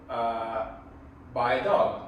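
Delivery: usual speaking voice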